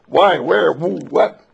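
high, loud sputtering questions; flustered questions. Acted. Meeting him at the door, firing flustered questions. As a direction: fire off the questions all at once, flustered.

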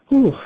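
high, loud astonished whew. Acted. Ingeborg reeling after talking to a bird, high and loud. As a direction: blow it out in amazement.

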